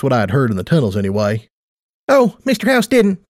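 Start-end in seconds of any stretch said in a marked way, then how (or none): 2.1-3.2 much higher, amused perky voice; amused Tight Lips. Acted. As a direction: correct her with amusement. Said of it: "seemed amused".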